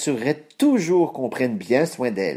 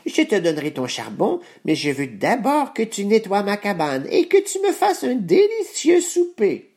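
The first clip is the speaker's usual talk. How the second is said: put-on old witch voice; 7 semitones higher than usual. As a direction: croon the threat like an old witch. Baba Yaga bargaining with girl.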